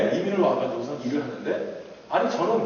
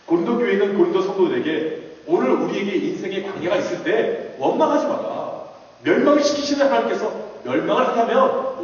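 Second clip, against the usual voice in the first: fiery preaching; 3 semitones higher, 4 dB louder than usual. Acted. Preacher exhorting the congregation not to complain, fervent. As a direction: preach it hard, drive it home.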